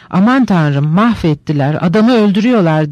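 ordinary delivery

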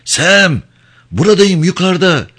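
high, loud desperate shout; shouting with all his strength. Acted. Trapped Crow shouting down to the platform, full-force yell. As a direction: throw every word down at full force. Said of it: "Bütün gücüyle bağırıyordu".

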